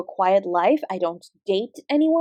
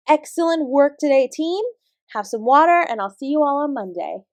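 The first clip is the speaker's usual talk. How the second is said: normal talking voice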